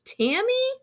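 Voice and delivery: high rising yell of a name; calling out. Acted. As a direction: shout the name across the room. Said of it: "until he yells".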